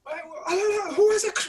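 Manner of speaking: high, loud panicked shouting; panicked guards. Acted. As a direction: guards yelling in confusion from afar. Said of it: "you hear the guards going".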